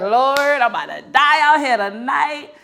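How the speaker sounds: slow, loud, high cry to heaven; mock panicked wail. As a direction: wail it out as a hammed-up plea. Pleading in fear, retold.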